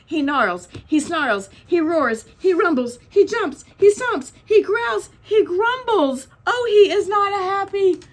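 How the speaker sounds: escalating storybook uproar; building dramatic narration. A narrator's reading. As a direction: hammer out each of the bear's actions, building. Bear's angry waking, listed verbs.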